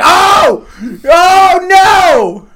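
very high, loud wailing shout; mock horrified scream. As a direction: re-enact the shock, hammed-up. Reenacting shock at film death.

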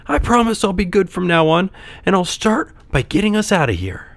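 high, eager puppet voice; earnest puppet. A narrator's reading. Puppet promises his father.

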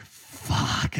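breathy, unvoiced swear under the breath; a hushed, exasperated curse at missing keys; frustrated curse. Late for training, cursing the lost keys under her breath.

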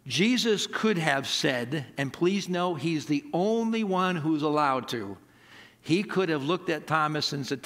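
ordinary talk

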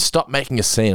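ordinary talk, nothing marked

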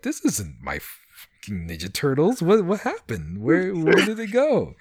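puzzled outcry, retold for laughs; mock bewilderment. Acting out his dismay at watching.

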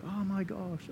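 soft, high gasp of wonder; hushed marvelling; awestruck. Acted. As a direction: act amazed, quietly and high. Acting out amazement at God.

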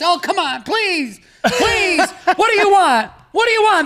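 rising desperate plea, pitch climbing with each please. Retold or imitated. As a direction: beg like a pushy salesman cornering a buyer. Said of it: "everyone said this".

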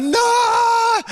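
very high, long howl of "No"; comically drawn-out agonised wail; mock scream. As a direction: scream it out in hammed-up agony. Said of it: "So I'm screaming downstairs".

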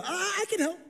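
put-on high, eager child's voice; little boy voice. Small boy offering help in a high eager voice. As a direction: pitch up like an excited little kid.

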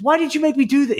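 high, loud mock whine of annoyance; angry complaint. Friend annoyed by the road trip game, mock-whining. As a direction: imitate a fed-up friend. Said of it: "he goes".

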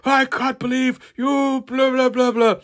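high, mock-furious parents. Friend retelling a comic, mocking Kitty's outraged parents in a high squeal. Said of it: "They're like".

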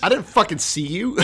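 high, loud mock exasperation; indignant outburst. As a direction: yell the excuse as flustered Balaam. Balaam's indignant reply to angel.

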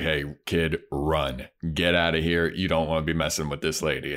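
low-voiced warning, lightly acted; lightly voiced low, terse rescuer; Angel. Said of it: "he says to the kid, he's like".

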